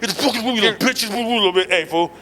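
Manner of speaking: cursing through clenched teeth; through gritted teeth. Retold or imitated. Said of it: "You start talking through your teeth?".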